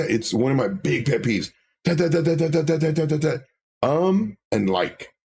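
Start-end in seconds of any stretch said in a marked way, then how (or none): 1.8-3.4 light ta ta ta mimicking keys; keyboard tapping imitation. Mimicking typing to mock filler words, light tapping. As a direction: tap out the sound of a keyboard. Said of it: "it's like somebody doing the keyboard".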